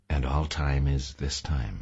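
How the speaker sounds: ordinary delivery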